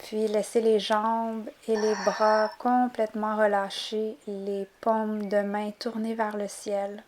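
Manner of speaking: soft, unhurried yoga nidra voice; hushed relaxation instructions with calm pauses; guided relaxation. Acted. Begins guiding the relaxation.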